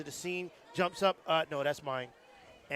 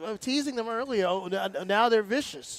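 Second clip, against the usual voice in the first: loud, higher-pitched teasing comeback; mock-indignant protest; 7 semitones higher, 9 dB louder than usual. Real, not acted. Co-announcer calls out colleagues who laughed at him, mock-indignant. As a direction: protest playfully, voice raised and up.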